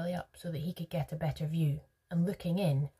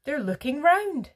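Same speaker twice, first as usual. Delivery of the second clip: high, loud triumphant cry; 10 semitones higher, 9 dB louder than usual. A narrator's reading. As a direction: crow it in victory. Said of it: "he cried triumphantly".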